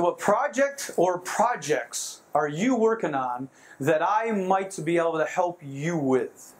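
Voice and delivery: very slow, each word laid out for notes; slow dictation. Shown as a demonstration. Speaker to audience, slow dictated statement. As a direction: spell it out slowly so they can write it down. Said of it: "You might wanna write that down".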